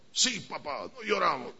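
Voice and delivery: a soft little yes to father; meek child. Pretend. Boy quietly answering his father that men don't cry.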